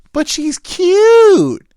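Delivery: very high, loud, drawn-out whine; whiny girl voice. Retold or imitated. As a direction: plead like a spoiled girl. Friend pleads for her.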